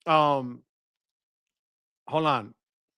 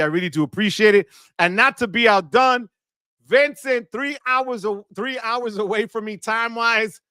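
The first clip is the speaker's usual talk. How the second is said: ordinary talk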